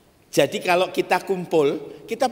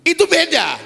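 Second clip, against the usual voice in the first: emphatic exclaim; 10 semitones higher, 6 dB louder than usual. Real, not acted. Preacher exclaims point.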